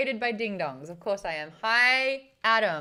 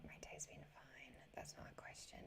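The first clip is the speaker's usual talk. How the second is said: whisper; 9 semitones lower, 27 dB quieter than usual. Real, not acted. Reading a chat question aside.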